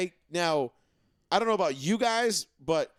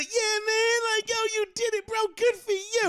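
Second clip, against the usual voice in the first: mock giddy falsetto, much higher; mocking giddy player. Mocking player hugging rival, in gushing high falsetto. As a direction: squeal it like a gushing buddy. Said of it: "the hugs and the smiles".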